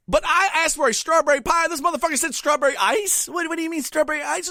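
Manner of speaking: high-pitched comic outrage; indignant, strained high complaint; outraged rant. Retold or imitated. Comic rant at drive-thru order mix-up.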